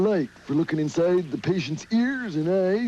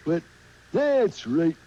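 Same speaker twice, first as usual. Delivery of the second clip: normal talking voice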